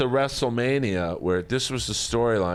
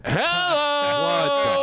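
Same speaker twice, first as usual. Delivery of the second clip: mock hello. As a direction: call it out long and high. Mock exclamation.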